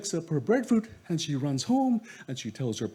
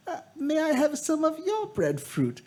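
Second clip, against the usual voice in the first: put-on high, crying plea of an old woman; old woman pleading; 13 semitones higher than usual. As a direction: beg like a frail old crone. Old woman asks again.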